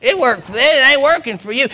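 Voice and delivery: mock the tired believer like a spirit; taunting evil spirit. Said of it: "where it can say".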